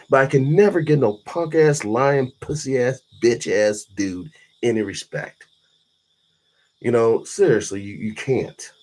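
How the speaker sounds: normal talking voice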